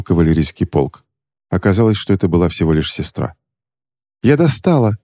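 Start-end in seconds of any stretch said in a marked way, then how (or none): 4.2-5.0 higher, happy cry; joyful exclaim. Acted. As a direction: call out gladly. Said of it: "радостно воскликнула Кристина".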